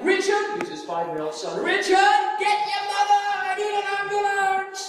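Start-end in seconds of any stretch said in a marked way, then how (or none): 0.0-0.6 a much higher, louder desperate call; injured father screaming for his son; screaming for help. Retold or imitated. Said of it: "He's screaming out". 1.6-4.9 a much higher, louder desperate call; injured father screaming for his son; screaming for help. Retold or imitated. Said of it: "He's screaming".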